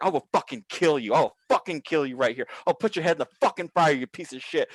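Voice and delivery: worked-up, cursing tirade; enraged threats. Retold or imitated. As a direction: threaten him right in his face. Retold threats, in his face.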